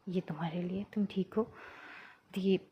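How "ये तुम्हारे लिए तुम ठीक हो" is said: softer, tender near-whisper; gentle concern. Retold or imitated. Teacher asks her injured student, soft and tender. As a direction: hand over the flowers gently, asking softly.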